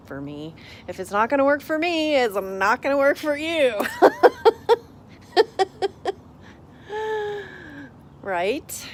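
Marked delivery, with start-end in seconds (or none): 0.9-3.7 loud, high emphatic punchline. Real, not acted. Telling viewers it won't work, loud emphatic. 3.8-8.1 loud, high burst of laughter; big laugh. Real, not acted. Laughing at her own line to viewers, big high laugh.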